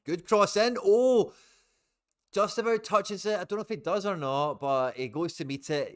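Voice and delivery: high, loud near-miss commentary; excited shout. Real, not acted. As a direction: yell it as the chance flashes past. Near goal chance for Celtic.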